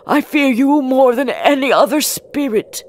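high, loud fearful confession; scared Scrooge. A narrator's reading. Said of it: "Scrooge was really scared".

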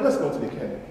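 a heavy-hearted call to go; sorrowful Jesus. Retold or imitated. Jesus tells the sisters to go to the cave, low and grieving.

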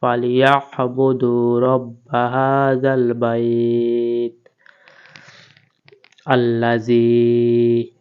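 drawn melodic chant of the ayah; slow recitation. Shown as a demonstration. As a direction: recite slowly, pressing the আইন from the throat. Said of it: "হলকের মধ্যখান থেকে চেপে পড়তে হবে".

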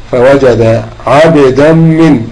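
عبدا من slowed right down, the tanween merged into من with a held nasal; slow tajweed demo. Shown as a demonstration. Teacher models the tanween merge for students, slow held nasal. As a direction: stretch عبدا من to show the merge. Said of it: "ما بين عبدا ومن".

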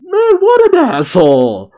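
high, loud cry of disbelief; outraged outburst. Real, not acted. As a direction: blurt it out, scandalized by the villain. Reader outraged at villain's taunt.

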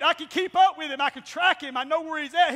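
normal talking voice

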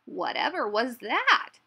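slow, loud astonished question; startled cry. A narrator's reading. Badger hearing a thud outside, crying out startled. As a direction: exclaim it, stretched and startled. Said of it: "he cried".

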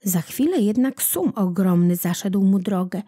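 ordinary delivery

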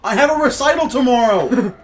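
high, loud laughing whine; mock pianist whine.

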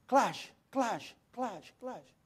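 imitated clashing. Imitating lights breaking floor by floor.

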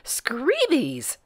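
high-pitched, contained storybook scream; mock scream. A narrator's reading. Sir Snips as another hat appears, mock scream. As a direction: squeal it high without much volume. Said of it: "screamed Sir Snips".

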